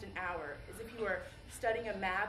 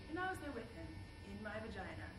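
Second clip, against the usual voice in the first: soft, fading to very quiet; hushed ending; 9 dB quieter than usual. Acted. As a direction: let it drift away, intimate and hushed. Hushed, intimate close of the monologue.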